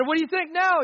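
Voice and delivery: shouted, high threat after the shot; loud mock gunman taunt. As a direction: hurl the taunt.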